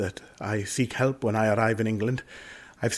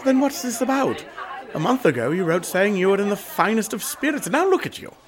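incredulous; 8 semitones higher than usual. Acted. Brother teasing, then concerned.

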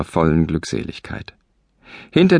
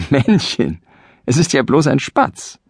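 amused mocking; 8 semitones higher than usual. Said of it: "lachte laut und rief".

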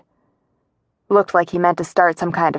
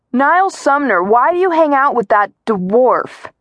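higher-pitched, sneering call; ugly taunt; 6 semitones higher than usual. A narrator's reading. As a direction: make the insult sound ugly. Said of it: "The way he said it made it sound ugly".

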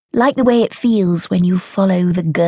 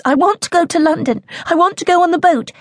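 put-on high, pushy girl's demand; insistent child; 12 semitones higher, faster than usual. Child pleading to leave.

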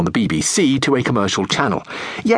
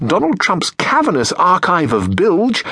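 ordinary delivery

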